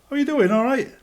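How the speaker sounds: security guard greeting people on his round; cheery greeting. Retold or imitated. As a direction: sing out a friendly hello to a passer-by. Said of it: "I just walk on and I go".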